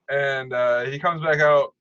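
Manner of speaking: ordinary delivery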